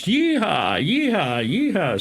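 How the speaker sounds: hammed-up yeehaw chant; drunken yeehaw. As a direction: whoop it up like a rowdy cowboy in a bar. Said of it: "and all you heard was".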